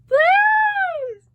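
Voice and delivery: high, loud exclamation; delighted shout. Real, not acted.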